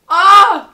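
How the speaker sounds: sudden loud high cry of anguish; emotional yelp. Real, not acted. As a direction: let out a loud, high pained "ah". Overcome thinking of Loki.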